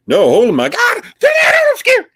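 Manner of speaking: real startled scream; jumpy shriek, pitch shooting way up; scared. Real, not acted. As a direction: cry out in sudden fright, high and loud. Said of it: "Okay, I got scared".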